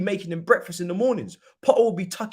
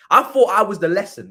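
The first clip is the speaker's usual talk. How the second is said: ordinary talk, nothing marked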